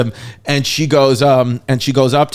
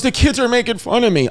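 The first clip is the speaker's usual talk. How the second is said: upset daughter; 10 semitones higher, faster than usual. Retold or imitated. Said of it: "She goes".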